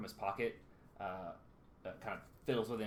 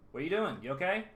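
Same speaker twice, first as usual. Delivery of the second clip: concerned; 8 semitones higher, 9 dB louder, faster than usual. Acted. Kirik checking on dazed Callum.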